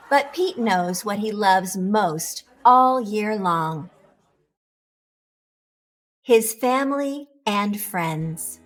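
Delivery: ordinary delivery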